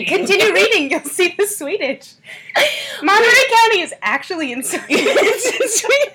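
high, loud, laughing tease. Pretend. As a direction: giggle through the joke. Teasing joke about Sweden.

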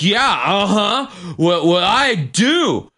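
slow, high mock drunk boast; Kimmy. Drunk woman claiming she knows French, mocking imitation. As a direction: stretch it out like a smug drunk. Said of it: "She's like".

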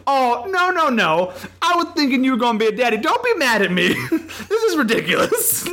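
high, laughing through the words; hammed-up high laughing plea; laughing mock apology. [name] apologising, voiced high laughing.

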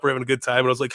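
ordinary talk, nothing marked

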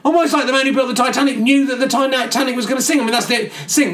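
ordinary talk, nothing marked

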